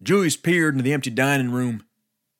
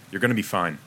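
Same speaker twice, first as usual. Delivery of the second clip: low, soft, deadpan reassurance; emotionless; 6 semitones lower, 4 dB quieter, faster than usual. A narrator's reading. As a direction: say it like it means nothing to you. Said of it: "said Stanton emotionlessly".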